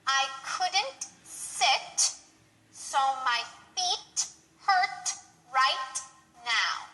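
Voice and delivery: drawn out slowly, word by word; slow demo for learners to copy; very slow model sentence. Shown as a demonstration. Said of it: "examples with T at the end".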